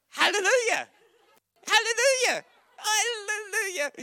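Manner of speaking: high, loud repeated praise; ecstatic hallelujah. Acted. Preacher praising at the climax, ecstatic. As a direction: lift it up in praise.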